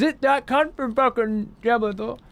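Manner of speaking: high, slow mocking voice; mocking quote. Streamer mimicking fans jeering at an actor, high and slow mock voice. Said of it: "all we heard the whole fucking Final Fantasy sixteen play for".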